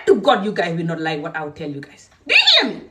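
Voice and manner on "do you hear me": very high, loud demand at the men; angry shout. Real, not acted. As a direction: yell it as a final warning. Warning the men she addresses.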